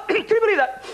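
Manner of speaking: incredulous. Retold or imitated. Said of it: "I went".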